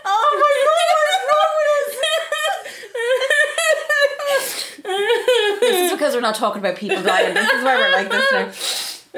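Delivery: laughing through the words; laughing hard. Real, not acted. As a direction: crack up while talking. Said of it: "It's hilarious".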